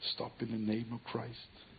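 soft, slow, hushed; hushed echo. Acted. The monk's last word echoing over the silent arena, hushed. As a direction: let it hang like an echo.

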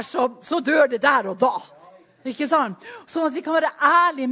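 loud, driving sermon voice; preaching at full force. Acted. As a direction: hammer the word home to the church.

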